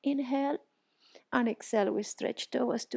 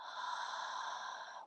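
breathy exhale; 13 dB quieter than usual. Shown as a demonstration. Ujjayi exhale demonstrated.

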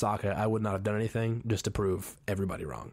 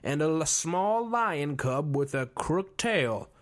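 mock fortune teller; 7 semitones higher, much slower than usual. Fortune teller announcing the cloud reading, slow high mock prophecy. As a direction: intone it like a grand seer. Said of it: "And she goes".

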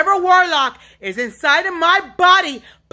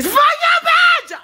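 raw screaming outburst; slower than usual. Real, not acted. Lashing out at unseen tormentors, shrieking. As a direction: shriek the curse out at full force. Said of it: "This yelling and screaming".

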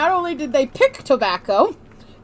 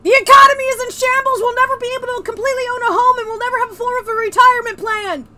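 loud, fast, panicked outburst; mock alarm shout; 10 semitones higher, 5 dB louder, faster than usual. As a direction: yell it like a doom siren. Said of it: "we have to scare you".

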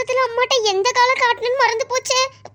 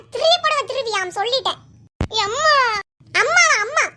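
ordinary talk

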